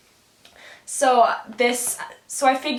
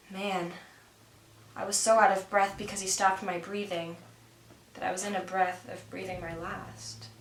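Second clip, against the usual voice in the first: much softer, settling after the shock; sit back and catch your breath quietly; calming down, soft; 8 dB quieter than usual. Acted. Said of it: "calm myself down".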